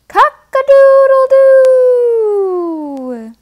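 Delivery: high, slow, loud drawn-out crow; rooster crow. Pretend. Rooster call in farm book.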